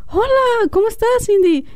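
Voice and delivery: very high-pitched mock squeal; acted-out high cry of greeting; retold shocked greeting. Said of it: "yo grito".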